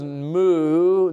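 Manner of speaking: low, drawn-out spoken moo; lightly imitated cow; cow's moo. Pastor voicing the cow in a children's story, lightly mooed. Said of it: "And the cow says".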